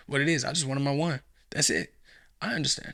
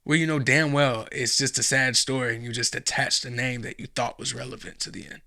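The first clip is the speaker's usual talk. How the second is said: ordinary talk, nothing marked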